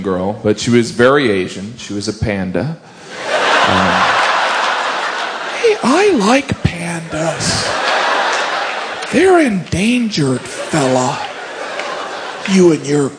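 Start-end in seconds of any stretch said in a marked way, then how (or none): none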